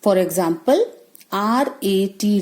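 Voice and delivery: ordinary talk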